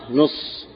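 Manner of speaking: "نص" as nuss, short u and doubled s; u on nun, doubled sad. Shown as a demonstration. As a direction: hold the doubled s in "نص" after a short u. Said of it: "بضم النون وتشديد الصاد".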